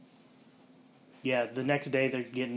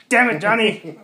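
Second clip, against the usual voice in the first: higher, louder exasperated snap; mock exasperation; 10 semitones higher, 14 dB louder than usual. [name] scolds Johnny, mock exasperated snap.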